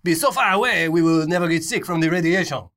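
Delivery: pompous French official voice; french chef. Pretend. Voicing French government, mock accent.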